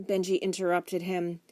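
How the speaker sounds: ordinary delivery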